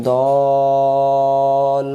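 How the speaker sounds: দল drawn out on a level chanted note; stretched madd. Shown as a demonstration. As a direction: hold the vowel in দল very long. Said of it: "কয় আলিফ টান দেবো". (দল-এ লম্বা টান)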